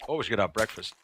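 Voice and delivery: usual speaking voice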